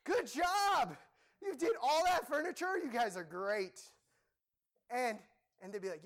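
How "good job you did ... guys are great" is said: high, slow, praising boss voice; impressed boss. Retold or imitated. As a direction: play the delighted boss handing out praise. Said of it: "And the boss".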